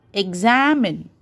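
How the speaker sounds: "examine" with a stretched am; stress on middle syllable. Shown as a demonstration. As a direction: lean on the am in "examine". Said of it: "am is stressed".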